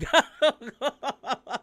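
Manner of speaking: high, loud burst of laughter. Real, not acted. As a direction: crack up loudly in disbelief. Cracking up at the lyrics. (malakas at mataas na halakhak)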